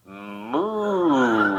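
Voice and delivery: a plain spoken Moo out the car window; lightly done cow call. Retold or imitated. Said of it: "I went".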